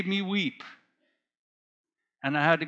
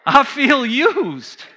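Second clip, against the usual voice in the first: higher, louder and slow, laughing through I feel used; 6 semitones higher, 5 dB louder, slower than usual. Real, not acted.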